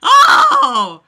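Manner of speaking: sudden high yelp; surprised yelp. Real, not acted. Mocking the novel aloud, a sudden startled yelp. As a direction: jolt out a startled cry. Sudden outburst mid-reading.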